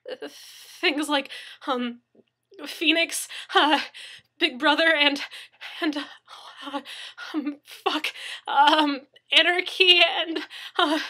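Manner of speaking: high, loud, tearful blubbering; crying and blubbering. Acted. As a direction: sob the words out. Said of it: "He was crying in earnest now".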